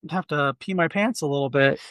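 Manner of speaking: usual speaking voice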